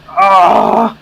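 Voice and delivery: high yelp of burning pain. Real, not acted. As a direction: cry out at the sting. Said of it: "My sinuses are on fire".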